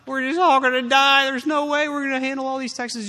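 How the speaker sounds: hammed-up doom of someone frozen; paralyzed with fear. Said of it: "The other thing you can do is freeze".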